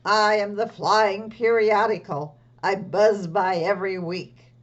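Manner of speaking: loud, proud creature announcement; flying magazine creature. Acted. Puppet magazine introduces itself loudly to the children. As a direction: announce yourself like a buzzing magazine. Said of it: "some other creatures who would like to say hello".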